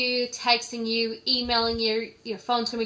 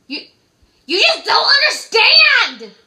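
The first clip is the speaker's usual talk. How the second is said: much higher and louder, slow, fed-up yell; exasperated high shout; 12 semitones higher, 13 dB louder, slower than usual. Retold or imitated. Caller yelling at the sleeper, slow and exasperated. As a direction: blow up at her for sleeping.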